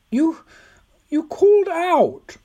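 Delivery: very high, slow insistence; insistent old woman. A narrator's reading. Old woman pressing Tom about his cry, very high and insistent. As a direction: press the point, high and drawn out. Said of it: "she insisted".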